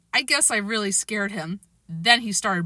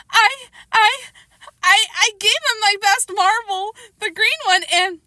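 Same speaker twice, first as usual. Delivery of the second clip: tearful stammer; 13 semitones higher, 4 dB louder than usual. A narrator's reading. Child stammers in tears.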